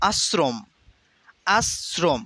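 আশ্রম said "as-srom", with a dental s; s sound in আশ্রম. Shown as a demonstration. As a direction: break আশ্রম as "as... srom", crisp s. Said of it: "উচ্চারণ যেভাবে হবে". (আশ্রম-এ দন্ত স, "আস্-স্রোম্")

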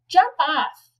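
loud, high storybook shout; calling out. A narrator's reading. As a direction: shout it up to a child on a swing. Child ordering sibling off swing.

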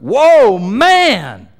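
loud, high, astonished whoa; Adam bursting out in shock at first seeing Eve; amazed exclamation. Retold or imitated. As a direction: blurt it out as if stunned by what you see. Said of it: "He was amazed".

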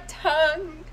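high, strained single word; imitated tearful stammer. Woman mimics her friend tearing up, high mock-tearful voice. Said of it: "tears are starting to".

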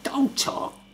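high, strained impression of dad; slow, drawn-out mock telling-off from dad; imitating dad. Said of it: "He's like".

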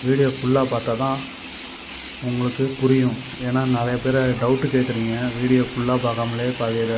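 normal talking voice

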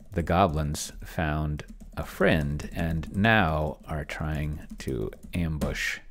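usual speaking voice

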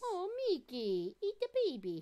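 high cooing pet talk. Real, not acted. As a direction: coo at the dog in a high voice. Talking to a pet.

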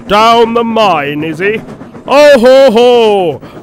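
loud, slow, booming mock mirth; hearty laughing. A narrator's reading. Gordon gloats over Thomas's fix, loud mocking laugh. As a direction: boom it out, ending in an acted guffaw. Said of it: "laughed Gordon".

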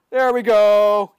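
hammed-up victory. Gamer gloating to stream chat after a kill, slow and loud. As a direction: savour each word like a gloat.

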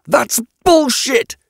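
very high, drawn-out outraged cry; slow, high-pitched furious outburst; screaming. A narrator's reading. Yatena rejects the witness's answer, screaming in anger. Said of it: "Yatena screamed".

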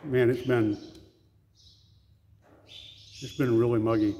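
talking around pipe stem; pipe in mouth. Real, not acted. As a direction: lips clenched on pipe. Seen 0: Pipe stem held between lips through all the labelled frames.

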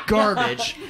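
ordinary talk

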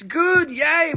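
high shouted burst of joy; excited cheer. Real, not acted. Cheering the fast sales pace.

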